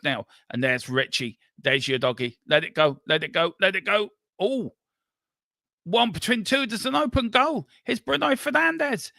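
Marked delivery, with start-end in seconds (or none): 2.5-9.1 rising excitement. Real, not acted. Commentator building as United break on an open goal, higher and higher. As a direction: build the tension toward the goal.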